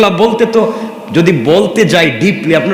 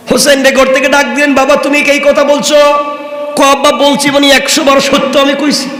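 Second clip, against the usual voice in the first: impassioned preaching; 9 semitones higher than usual. Acted. Preacher telling the story of Husain to the crowd, impassioned. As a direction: sweep the crowd along in full voice.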